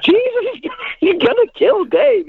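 high, laughing, half-shocked cry; laughing alarm. Retold or imitated. To Bob Bremer tearing Dave's muscle, laughing shocked cry. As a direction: yelp it out while laughing in disbelief. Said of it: "And I went".

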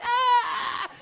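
high, loud playful scream; mock scream. Friends screaming on the roller coaster, mock scream. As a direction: scream like riders on the drop.

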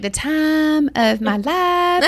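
high, drawn-out Southern drawl, imitating a friend; Kelly's Southern accent. Host playfully copying her guest's accent, exaggerated Southern drawl. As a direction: stretch the vowels in an admiring mock Southern accent. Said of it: "I just love your accent".

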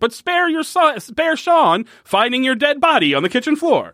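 high pitched commercial pitch; hard sell ad. Retold or imitated. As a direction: sell it like a cheesy ad. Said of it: "doing it to the style of a commercial".

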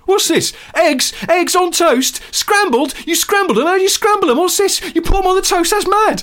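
very high, loud staccato questions; hammed-up hyperactive TV host; astonished presenter. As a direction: shout each discovery in amazement. Presenter amazed by scrambled eggs.

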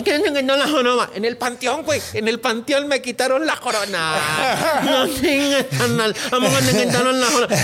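high, mock nasal voice; nasal-speaking man. The nasal man asks about the hump, mock nasal voice. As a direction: imitate a man talking through his nose. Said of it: "un gangoso".

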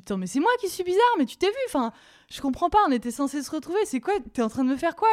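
high, fast indignant outburst; shocked girlfriend snapping back at the boyfriend; outraged. Retold or imitated. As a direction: fire it back in disbelief. Outraged retort in quarrel.

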